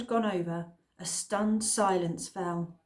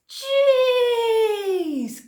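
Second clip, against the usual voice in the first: very high, loud cry; cried out; 10 dB louder than usual. Acted. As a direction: cry it out in shock. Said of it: "Cliff cried".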